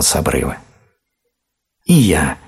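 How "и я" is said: slightly raised eager call; lightly acted hedgehog cry; mild shout. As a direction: call out to join in. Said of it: "крикнул ёжик".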